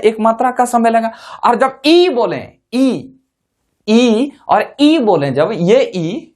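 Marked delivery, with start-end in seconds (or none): 1.4-6.3 ई drawn out slowly; stretched vowel. Shown as a demonstration. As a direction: hold each ई long to show the length. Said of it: "ये ई बोले तो ज्यादा समय लग रहा है".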